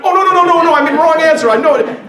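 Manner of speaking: mock panic at giving the wrong answer; panicked outburst. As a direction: backpedal in a hammed-up panic. Husband realizing wrong answer.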